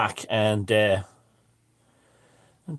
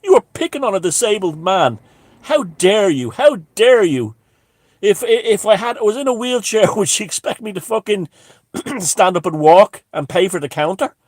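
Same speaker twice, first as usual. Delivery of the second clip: higher, louder indignant tirade; a theatrical "how dare you" with extra volume; hammed-up outrage; 8 semitones higher, 6 dB louder than usual. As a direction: play up the wronged victim for the crowd. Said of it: "I was sexing the whole thing up".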